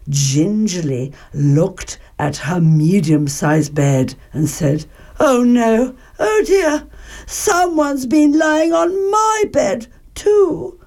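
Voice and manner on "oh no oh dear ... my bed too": high, slow fretting; high-pitched storybook mother's dismay; dismayed Mother Bear. A narrator's reading. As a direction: fuss like a worried mama bear. Mother Bear finds bed disturbed.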